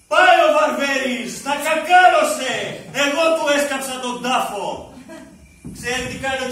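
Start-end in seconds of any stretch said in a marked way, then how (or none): 0.1-4.9 gloating. Acted. Gloating over rival's death.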